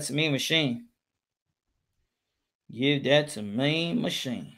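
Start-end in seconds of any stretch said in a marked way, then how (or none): 2.7-4.5 slow, low, stretched-out scoring call; drawn-out call. Real, not acted. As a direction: draw out each word, low and unhurried.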